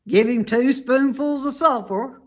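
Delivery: loud, matter-of-fact orders; gruff farmer. Acted. Mister Zuckerman instructing Lurvy, loud and brisk. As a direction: prescribe it briskly. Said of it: "said Mister Zuckerman".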